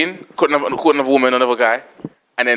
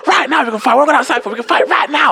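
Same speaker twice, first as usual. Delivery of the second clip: high-pitched, insistent fighting challenge; hammed-up hothead demanding a fight; acted aggressor; 10 semitones higher than usual. Acted queue confrontation, demanding a fight.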